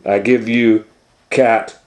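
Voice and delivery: showman announcer. Acted. Doctor Varmint unveiling Cat Kong to the crowd, ringmaster voice. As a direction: present it to the crowd.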